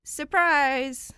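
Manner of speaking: high, loud sheepish surprise; sheepish and glowing. Acted. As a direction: sing it out, a little embarrassed. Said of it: "sheepish and glowing".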